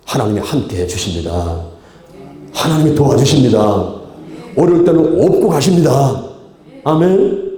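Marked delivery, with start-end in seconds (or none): 2.5-7.5 loud, rising proclamation from the pulpit; forceful preaching. Real, not acted. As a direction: drive the promise home to the congregation. (힘차게 외치는 설교)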